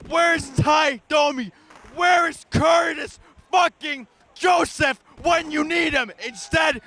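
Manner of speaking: furious hockey fan yelling at his team; angry rant. Acted. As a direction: rage at the team like a heartbroken fan. Fan ranting after playoff loss.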